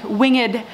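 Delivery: winged as "wing-id", both syllables sounded; two-syllable pronunciation. Real, not acted. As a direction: say winged with the e pronounced. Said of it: "is fun to say".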